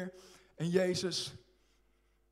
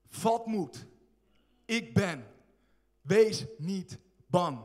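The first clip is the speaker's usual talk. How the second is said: slow, weighty words of comfort from Jesus; calm them like a master over the storm; Jesus speaking; 4 dB louder, much slower than usual. Acted. Jesus to frightened disciples, slow firm reassurance. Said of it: "het eerste wat hij zegt".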